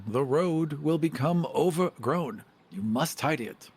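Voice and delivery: normal talking voice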